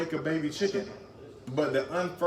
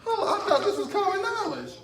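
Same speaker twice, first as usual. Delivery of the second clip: surprised; 3 dB louder than usual. Real, not acted. Surprised nobody knows.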